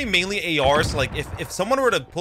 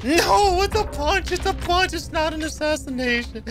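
indignant protest; 11 semitones higher, slower than usual. Real, not acted. Protesting a missed assassination.

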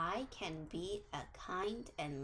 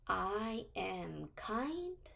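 very slow, each word spaced out; slow affirmation for kids to repeat; much slower than usual. Shown as a demonstration. Teacher leads children in a slow affirmation. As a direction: lay it out word by word for the class to echo.

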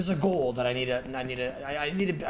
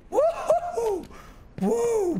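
very high whooping cheer; 3 dB louder, much slower than usual. Real, not acted. Streamer clearing a tricky jump, excited whoop. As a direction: whoop like you just won. Celebrating game progress.

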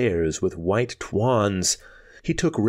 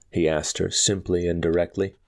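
plain reading voice

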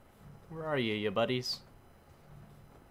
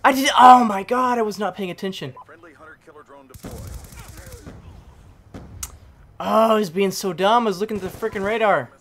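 high, loud self-reproach; real exasperated outburst; frustrated shouting; 7 semitones higher, 11 dB louder than usual. Real, not acted. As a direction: yell at yourself over a stupid mistake. Berating self after dying.

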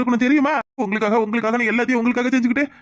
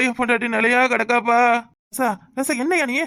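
ordinary delivery

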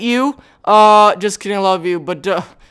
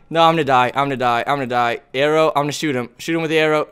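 normal talking voice

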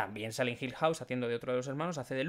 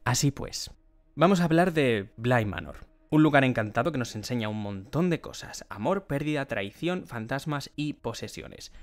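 louder, staged intro voice as the plot summary begins; podcast announcer read; 2 semitones higher, 8 dB louder than usual. Acted. Host announcing the plot summary to listeners, louder announcer read. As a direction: present the show like a trailer narrator.

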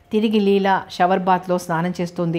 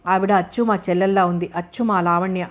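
usual speaking voice

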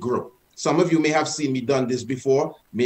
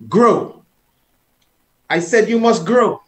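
put-on louder, higher order, as if disciplining a child; energetic stern command; 5 semitones higher, 5 dB louder than usual. Shown as a demonstration. Teacher commanding his own hand to grow, forceful and stern. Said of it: "put your energy in the hand".

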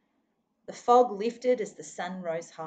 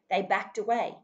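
ordinary delivery

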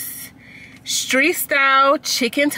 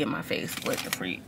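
breathy annoyed whisper; 10 semitones lower, 14 dB quieter, faster than usual. Real, not acted. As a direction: hiss it under your breath. Sun glaring in her face.

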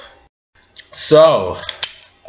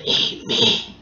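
soft whispered call; eerie whisper; 6 dB quieter than usual. Retold or imitated. The Pringles calling again, eerie whisper. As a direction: whisper it like a haunting voice. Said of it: "looked at me again and said".

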